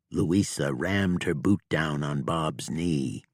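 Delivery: plain reading voice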